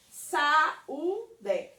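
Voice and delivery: Saúde in three short beats, SA, Ú, DE; Saúde broken up as sa, ú, de; split into syllables. Shown as a demonstration. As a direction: separate each syllable of Saúde. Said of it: "O U se separa do A".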